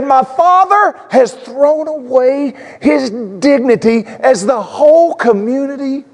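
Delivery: high, slow, seething voice; seething older son. Retold or imitated. Older son seething at his father, high and slow. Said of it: "Maybe he was seething".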